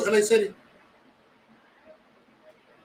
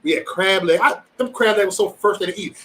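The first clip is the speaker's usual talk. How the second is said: ordinary talk, nothing marked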